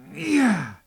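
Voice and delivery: high, loud cheer; excited yeah. Acted. Teller cheers as the prince opens the door, loud yeah. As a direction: burst out with joy.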